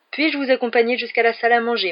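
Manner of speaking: high, loud rehearsed courtesy; sing-out, over-polite recital; affected politeness. A narrator's reading. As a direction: play the phoney little gentleman.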